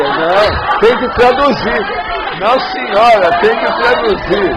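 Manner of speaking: high, very loud insistence; loud joking demand. Real, not acted. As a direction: demand it loudly over the room. Loudly demanding a translation in jest.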